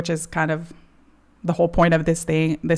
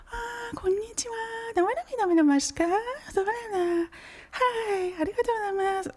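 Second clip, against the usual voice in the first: put-on very high, soft, sugary shop-girl voice; cutesy Japanese girl voice; 14 semitones higher, 4 dB quieter than usual. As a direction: squeak it out like an overly polite cashier. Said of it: "Japanese girl voice".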